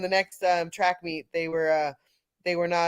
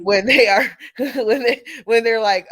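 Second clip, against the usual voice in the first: laughing; 6 semitones higher, 7 dB louder than usual. Real, not acted. Laughing mid-story at the boys.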